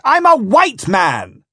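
loud, high, slow protest; indignant shout. Acted. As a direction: yell it word by heavy word. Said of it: "I shouted".

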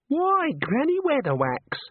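put-on high, slow, cheery young woman; happily. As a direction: gush a bright, high greeting. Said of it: "said the girl happily".

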